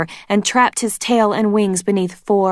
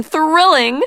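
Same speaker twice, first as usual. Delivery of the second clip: thrilling! squealed much higher; delighted; 10 semitones higher, 5 dB louder than usual. A narrator's reading. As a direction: pitch thrilling! way up in glee. Queen repeats word rising.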